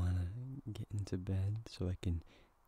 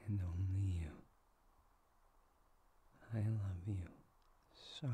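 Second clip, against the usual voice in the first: soft unvoiced whisper of devotion; intimate whisper; 5 dB quieter than usual. Whispering a vow while kissing the partner's hand. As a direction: hush it close, like a promise.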